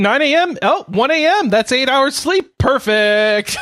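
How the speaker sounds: mock cheerful. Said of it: "so I was like".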